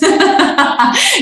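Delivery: high-pitched burst of laughter; real giggling fit, pitched high. Real, not acted. Joking about Warren.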